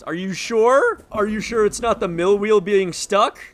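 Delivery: high, loud mock-frightened questioning; scared horror game streamer. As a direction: squeak it out in fake fright.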